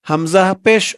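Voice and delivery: ordinary talk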